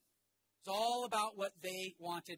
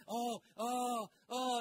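fawning oohs; 4 semitones higher, 7 dB louder, much slower than usual. Retold or imitated. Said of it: "they're just doing".

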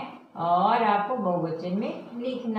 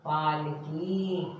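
पालकी softer and slow; 4 semitones lower, 5 dB quieter, much slower than usual. Shown as a demonstration. Teacher quietly repeating पालकी slowly. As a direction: ease out पालकी gently. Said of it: "modelling the word".

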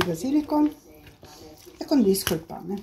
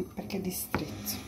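whispering; 13 dB quieter than usual. Real, not acted. Cooking aside, unvoiced.

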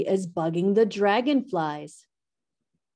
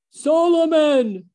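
loud, drawn-out hippo roar, pitched up; 8 semitones higher, 7 dB louder than usual. A narrator's reading. Hippo roaring at Solomon. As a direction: bellow the name slowly like a huge angry hippo. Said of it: "roars the biggest hippo".

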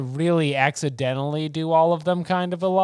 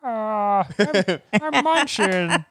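slow, high whiny impression; Ray Romano voice; 6 semitones higher, much slower than usual. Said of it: "It's just Ray Romano".

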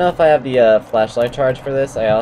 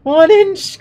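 even higher, shrill shouted boast; squealing triumph; 8 dB louder than usual. Real, not acted. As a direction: crank the glee up further. Mock triumph escalates.

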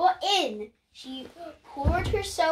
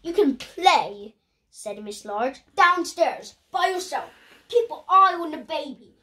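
firm Mum voice; 4 semitones higher, 7 dB louder than usual. Acted. Mum sends the children away.

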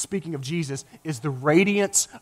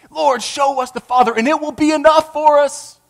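higher, eager plea for the Father; excited Philip; 10 semitones higher, 5 dB louder than usual. Retold or imitated. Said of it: "I bet he was so excited".

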